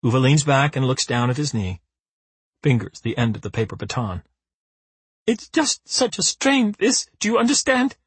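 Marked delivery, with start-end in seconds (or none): 5.2-8.0 sobbing. A narrator's reading. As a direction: sob it out. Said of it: "she sobs".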